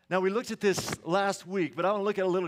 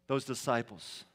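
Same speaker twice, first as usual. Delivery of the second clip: ordinary talk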